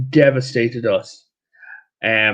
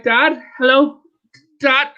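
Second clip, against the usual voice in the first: higher, urgent call for her dad; anxious daughter on phone; 9 semitones higher, 3 dB louder, slower than usual. Retold or imitated. Daughter trapped in Dublin calling her father, higher and urgent. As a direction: call out for Dad down a bad line.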